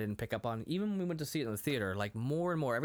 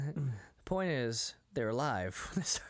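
usual speaking voice

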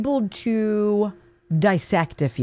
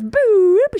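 high, loud "boop" sliding upward; cartoon noise of a nose growing; Pinocchio's nose boop; 12 semitones higher, 6 dB louder than usual. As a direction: swoop up on a loud mock beep. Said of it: "That's Pinocchio's nose".

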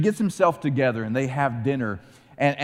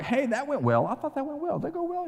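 much higher, climbing with each line, mock cheerful; clueless brothers; faster than usual. Preacher mimics brothers leaving Egypt, high and naive. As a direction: play the brothers as naive goofs, rising higher. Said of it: "They're like".

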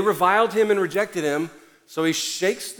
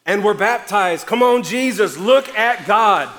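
loud, higher, driving sermon climax; preaching at full force; 5 semitones higher, 6 dB louder than usual. Acted. Pastor urging the church to keep sharing, loud preaching. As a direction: fire up the congregation.

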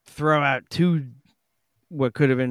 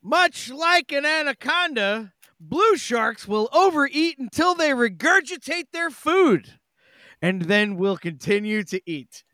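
much higher, goofy read-aloud voice; high silly voice; 11 semitones higher than usual. Pretend. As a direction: read it out in a squeaky cartoon voice. Reading shark fact, put-on voice.

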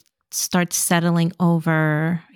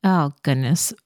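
normal talking voice

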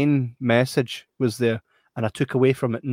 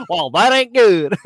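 higher, louder put-on American accent; mock American accent; 8 semitones higher, 6 dB louder than usual. Trading jokes with the co-host, a higher louder mock American accent. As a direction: drawl it like a worried yokel in a mock American accent.

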